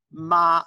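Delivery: Ma held high and level, loud; first tone Ma. Shown as a demonstration. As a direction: sing Ma on one steady high note. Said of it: "Sing along at home".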